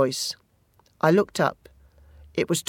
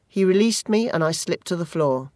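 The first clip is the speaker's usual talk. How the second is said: plain reading voice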